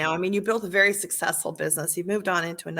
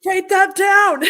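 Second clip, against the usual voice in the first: high, loud, excited "write that down"; mock eager note-taker; 11 semitones higher, 8 dB louder, much slower than usual. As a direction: shoot it out like an eager student grabbing a pen. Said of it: "I'm like".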